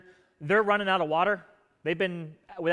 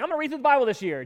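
higher, quicker, keen new-year resolve; eager reader; 7 semitones higher, 4 dB louder, faster than usual. Retold or imitated. Eager Bible reader announcing the year's plan. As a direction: sound all fired up, a bit quick. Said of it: "People are like".

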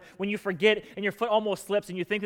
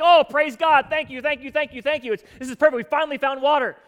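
much higher, louder, ecstatic gratitude; overjoyed thanks; 7 semitones higher, 8 dB louder than usual. Retold or imitated. Thirsty Israelites finding an oasis, overjoyed and loud. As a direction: gush with relief like parched travelers.

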